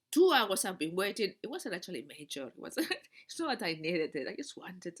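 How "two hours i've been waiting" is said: grumpy complaint. Retold or imitated. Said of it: "taken over by my grumpy sides".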